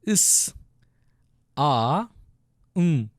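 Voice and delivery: "is", "a", "um" one sound at a time; sounding out letters. Shown as a demonstration. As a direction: hold each of "is", "a", "um", pausing between. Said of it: "Let's pronounce it".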